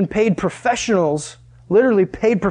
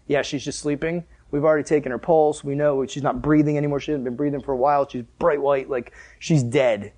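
mocking; 5 semitones lower, 4 dB quieter than usual. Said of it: "They mocked him like".

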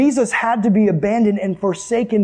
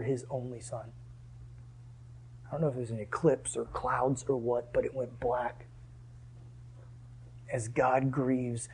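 low, soft, grave preaching; somber preaching hush; 8 semitones lower, 14 dB quieter than usual. Real, not acted. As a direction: let the voice sink into a solemn hush. Preacher drops to grieving hush.

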